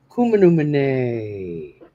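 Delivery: slow, drawn-out eerie female voice, lightly done; imitating a spirit voice. Replaying a cemetery recording for the listener, slow eerie mock female voice. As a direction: mimic the strange woman's voice from the recording, stretching it out. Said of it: "she sounds, I don't know, to me, I said native".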